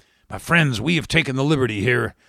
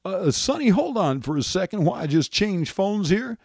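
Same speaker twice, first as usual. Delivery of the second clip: Bill Clinton; 7 semitones higher than usual. Acted. President distracted mid-call.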